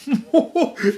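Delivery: big high jovial belly laugh; jolly laugh. A narrator's reading. Fezziwig greeting his apprentices, jolly laugh. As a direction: laugh all over yourself. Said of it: "laughed all over himself".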